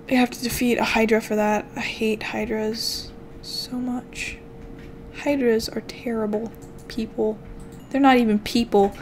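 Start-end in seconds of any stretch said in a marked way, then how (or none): none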